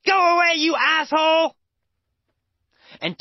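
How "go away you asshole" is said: angry shout. Acted. Homeless people yelling at Tony, angry shout. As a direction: shout him off the street. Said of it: "They'll say".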